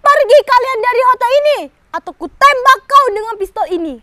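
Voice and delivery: loud, high threatening order; angry threat. Acted. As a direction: threaten them at gunpoint. Soldier threatens to shoot.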